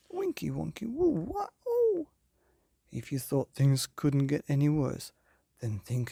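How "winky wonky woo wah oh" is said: rising silly wobble noises ending in a high oh; squeaky spinning cartoon cries; comic wail. A narrator's reading. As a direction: let the sounds climb up and up.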